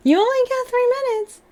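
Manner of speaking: high squeaky put-on voice. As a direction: pitch way up like a cartoon.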